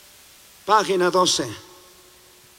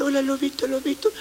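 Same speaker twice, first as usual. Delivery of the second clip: softly greeting "Hola, lobito"; naive greeting; 6 semitones higher, 4 dB quieter, faster than usual. Retold or imitated. Naive believer greeting the wolf, soft coaxing. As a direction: coax the little wolf like a trusting child.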